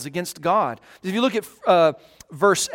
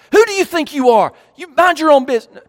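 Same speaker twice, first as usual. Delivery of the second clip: high, loud, fast indignant outburst; defensive outburst; 8 semitones higher, 6 dB louder, faster than usual. Pretend. Man snapping at a caring brother, mind your own business. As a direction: bristle and bite back. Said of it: "get defensive".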